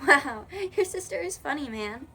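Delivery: tossing it out through a laugh. A narrator's reading. Kira to the narrator, amused: laughing. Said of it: "Kira finds this very funny and says".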